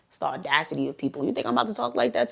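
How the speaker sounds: fast, whispered side comment; whispered aside. Real, not acted. Storyteller whispering a quick aside to her viewers about the client.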